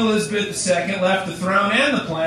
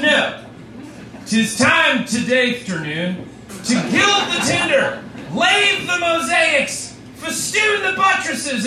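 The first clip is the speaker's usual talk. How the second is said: town crier; 2 semitones higher than usual. Acted. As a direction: declaim grandly to the crowd. Mock royal proclamation.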